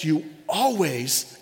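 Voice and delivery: "always" drawn out slowly at a low pitch; stress on ALWAYS. Retold or imitated. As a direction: stretch the word "always" for emphasis.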